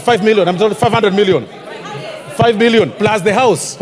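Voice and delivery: usual speaking voice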